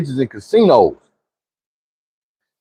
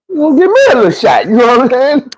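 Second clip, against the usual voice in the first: excited; 8 semitones higher, faster than usual. Retold or imitated. Excited imagining.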